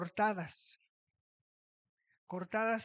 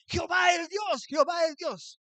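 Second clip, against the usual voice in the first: loud, high shout of the people; crowd shouting; 8 semitones higher, 6 dB louder than usual. Retold or imitated. Preacher acting out the people shouting, loud high cry. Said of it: "Empezó a gritar".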